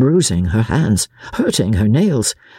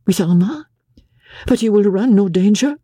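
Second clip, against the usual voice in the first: higher, fearful question; worried wife; 8 semitones higher than usual. A narrator's reading. Wife worried about husband's danger, anxious. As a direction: concern breaking through.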